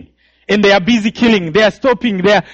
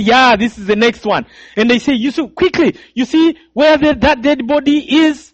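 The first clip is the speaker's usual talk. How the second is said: higher, louder barked orders; rough killers' orders; 6 semitones higher, 3 dB louder than usual. Retold or imitated. Killers ordering the boy to lie by a body, higher and louder. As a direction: hurry him toward the body.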